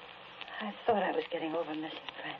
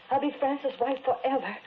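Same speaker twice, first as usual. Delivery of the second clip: fervent vow; 7 semitones higher, 6 dB louder than usual. Acted. Widow vowing eternal loyalty.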